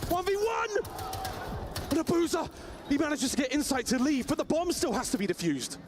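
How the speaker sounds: high, urgent, rising call; excited play-by-play. Real, not acted. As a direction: yell out the clutch as it unfolds.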